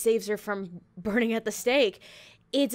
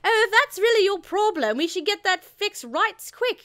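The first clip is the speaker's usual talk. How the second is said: a high, teasing English lady, louder at first; mock flirty Viv; 9 semitones higher, 4 dB louder than usual. The critic quotes Viv teasing Maria in a flirty English voice. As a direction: purr it like a cheeky mature witch. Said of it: "Viv is like".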